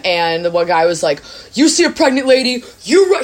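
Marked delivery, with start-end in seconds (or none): 1.5-3.2 high, loud mock Boston tough-guy warning; Boston accent. Embassy guy warning students about pickpockets, mock Boston accent. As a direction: ham up a Boston embassy man scaring students. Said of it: "He had, like, a thick Boston accent".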